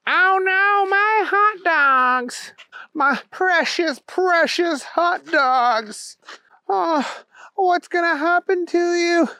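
put-on a childlike hero mourning his spilled treasure; Booger's wail. Booger wails over his spilled hot dogs, high and slow.

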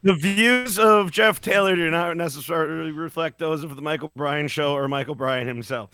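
mock disclaimer. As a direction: rattle it off like a legal disclaimer.

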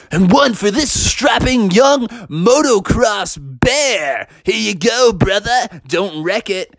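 high, drawn-out showman presentation; hype-man employee. Acted. Employee presenting Bubby his motocross bike, hyped showman. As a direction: build him up like a ring announcer. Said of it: "rolled it up to Bubby and said".

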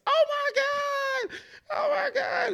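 shrill mock scream, very high; mock screaming girl. Retelling the club girl screaming in his face, high mock scream. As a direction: imitate her panicked screaming. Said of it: "screaming at the top of her lungs".

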